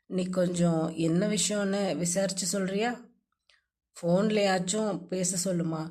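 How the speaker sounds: lightly voiced sad man asking for help. A narrator's reading. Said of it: "என்றான் சோகமாக".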